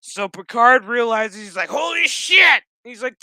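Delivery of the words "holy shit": loud, high-pitched outburst of disbelief; comic shocked shout; mock shock. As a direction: act out a startled exclamation, hammed-up. Acts out Picard's shocked realization.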